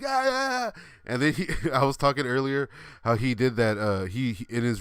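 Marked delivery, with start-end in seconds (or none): 0.0-0.8 high-pitched mock electrocution yell; imitated yelling. Storyteller imitating friend being shocked at the mic, mock yelling. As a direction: wail in short bursts as if being shocked. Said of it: "it's just yelling in the mic".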